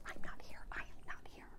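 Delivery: hushed, breathless whisper. Retold or imitated. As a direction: whisper it so the caller can't hear. Boss dodging a phone call.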